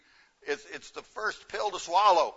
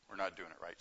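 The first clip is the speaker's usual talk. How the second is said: "We're not doing it right" repeated much softer, "not" still marked; quiet echo; 8 semitones lower, 12 dB quieter, faster than usual. Shown as a demonstration. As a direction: let the repeat sink, almost to yourself.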